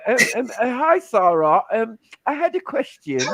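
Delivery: high posh put-on voice. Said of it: "do a special posh voice".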